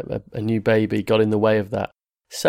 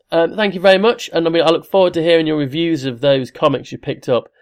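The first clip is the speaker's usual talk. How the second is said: ordinary delivery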